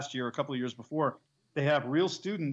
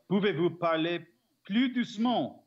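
French phrase; 4 semitones higher than usual. Shown as a demonstration. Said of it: "the other phrase I know is".

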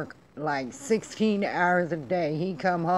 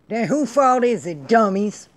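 loud, raised-pitch angry challenge; exasperated scolding; 4 semitones higher, 7 dB louder than usual. Real, not acted. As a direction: scold the fools you're fed up with. Ranting at reckless protesters.

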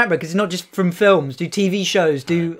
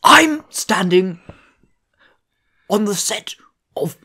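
David Attenborough impression. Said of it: "That's David Attenborough".

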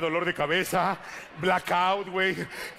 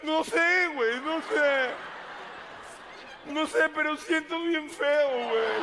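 put-on high, slow, crying drunk; drunken sob; 6 semitones higher, slower than usual. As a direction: wail it like a sloppy weepy drunk. (lloriqueo de borracho, agudo y lento)

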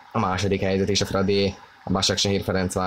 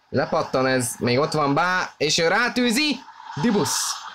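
building to a high excited call; excited hungarian sports commentary; 7 semitones higher, slower than usual. Real, not acted. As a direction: voice climbs high on the shot. Shot on goal, live commentary. (izgatott sportközvetítés)

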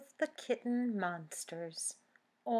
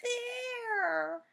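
high, louder eager cry; excited point-out; 5 dB louder than usual. Acted. Pip showing Grandpa the monster, high and eager. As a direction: call it out pointing.

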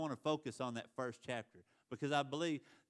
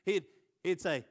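usual speaking voice

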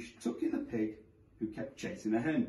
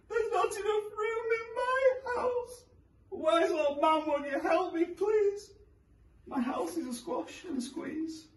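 put-on high, wailing old lady voice; complaining old lady. A narrator's reading. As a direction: whine it like a fretful old woman. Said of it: "grumble and grouse".

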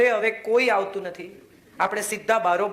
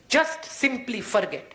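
drawn-out, deliberate advice; slow and deliberate; slow emphasis; 4 semitones higher, much slower than usual. Real, not acted. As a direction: stretch each word out slowly.

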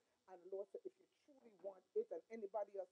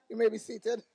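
loud words through laughter; amused, laughing instruction; 4 semitones higher, 18 dB louder than usual. Real, not acted. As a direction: say it while laughing. Laughing while seating congregation.

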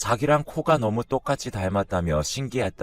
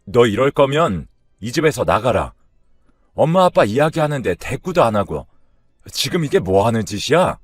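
loud, angry scolding; angry father; 3 semitones higher, 6 dB louder than usual. Acted. Father telling off his unresponsive daughter, loud and angry. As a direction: scold hard with raised volume. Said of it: "애기 아빠가 화를 냈습니다".

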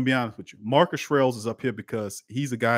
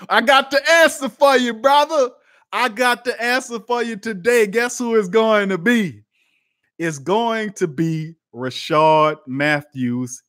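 much louder and higher, hyped up; hyped announcement; 11 semitones higher, 9 dB louder than usual. Real, not acted. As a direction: announce it like big news, pumped. Hyped reveal to the viewer.

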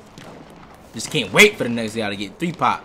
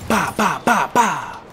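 mock gun noises; 8 semitones higher, 6 dB louder, much slower than usual.

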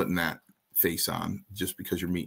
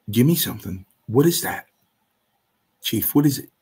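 higher, punchy nagging questions; insistent pleading; 3 semitones higher, 4 dB louder than usual. Retold or imitated. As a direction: press for an answer, pitch jumping up. Retold nagging to learn the secret.

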